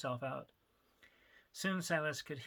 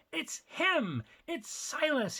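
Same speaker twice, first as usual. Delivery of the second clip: shrieking high with delight; squealing for joy at the sight; gleeful scream; 13 semitones higher, 5 dB louder, much slower than usual. Acted. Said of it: "he screamed with glee".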